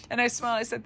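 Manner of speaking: ordinary delivery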